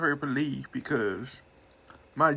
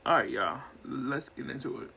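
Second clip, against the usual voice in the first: usual speaking voice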